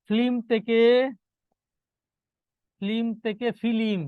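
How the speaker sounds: ফিল্ম first as flim, then as fi-lim; drawn-out ফিল্ম, an i slipped between f and l on the last; inserted vowel. Shown as a demonstration. Teacher quizzes class on flim becoming filim, slow pronunciation demo. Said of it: "এই মধ্য স্বরগমটা পরীক্ষায় বেশি আসে".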